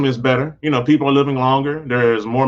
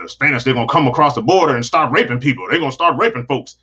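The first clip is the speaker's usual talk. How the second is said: usual speaking voice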